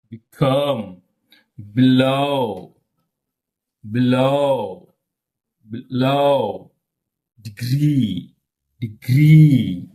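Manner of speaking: each word like "relax", "below", "degree" with a long falling second syllable; stress on second syllable. Shown as a demonstration. Teacher drills weak-prefix words, second syllable drawn out. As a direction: clip the prefix, stretch and lean on LAX, LOW, GREE. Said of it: "That is this".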